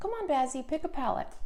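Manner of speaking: a touch of high pet talk; dog voice. Real, not acted. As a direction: lightly coax the dog, sing-song. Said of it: "I do use my dog voice in this video".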